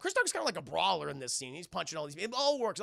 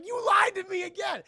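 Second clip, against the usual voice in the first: loud, high-pitched shout; outraged accusation; 12 semitones higher, 10 dB louder than usual. Retold or imitated. Carter to his partner who lied, outraged shout. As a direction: yell it at the partner who deceived you. Acted quarrel between the film partners.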